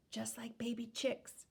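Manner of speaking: soft unvoiced whisper aside. Real, not acted. Reading a picture book aloud, a whispered aside. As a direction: breathe it as a little secret.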